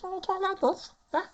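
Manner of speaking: very high, squeaky silly voice; really silly Miranda. Shown as a demonstration. Trying out a voice for Miranda, really silly. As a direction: go absurdly high. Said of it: "you're gonna go really silly".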